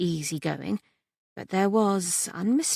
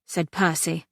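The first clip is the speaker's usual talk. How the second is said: plain reading voice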